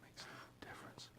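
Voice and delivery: hushed whispered aside; whispering. Real, not acted. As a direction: whisper it to yourself.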